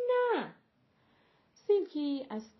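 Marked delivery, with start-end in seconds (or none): 0.1-0.5 high, loud cry of alarm; shout. A narrator's reading. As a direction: yell no to stop her. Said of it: "گملوتی فریاد زد". (فریاد بلند و زیر)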